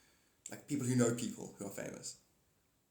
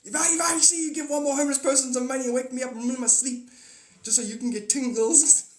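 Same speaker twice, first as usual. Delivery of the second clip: high, loud, rapid tirade; hammed-up sleep-deprived rant; grumpy Holy Spirit; 10 semitones higher, 9 dB louder, faster than usual. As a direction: threaten furiously in one rush. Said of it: "who's not a morning person".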